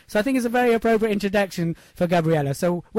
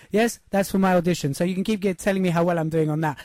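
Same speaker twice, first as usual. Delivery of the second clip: usual speaking voice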